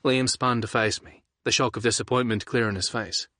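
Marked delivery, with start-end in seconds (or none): none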